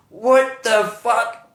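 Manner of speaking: slow, high-pitched incredulous reaction; baffled disbelief. Retold or imitated. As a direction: draw it out in loud, stunned disbelief. Retelling his bafflement at the actor.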